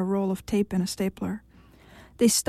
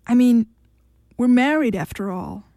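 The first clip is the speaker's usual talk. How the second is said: pouting; 3 semitones higher, 5 dB louder than usual. A narrator's reading.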